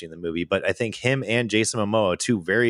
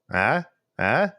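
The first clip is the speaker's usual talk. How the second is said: ordinary delivery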